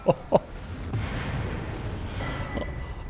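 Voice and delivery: breathy, mostly voiceless laughing fit; long laugh. Real, not acted. Player laughing at his own hit, long breathy laugh. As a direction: let a long laugh run out. Laughs after a throw lands.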